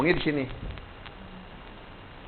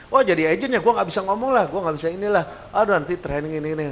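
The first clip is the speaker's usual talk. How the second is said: dismissive prospect; 6 semitones higher than usual. Retold or imitated. Prospect scoffs at the agent pitch, mock dismissive. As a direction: brush off the offer, half-mocking. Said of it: "semua jadi".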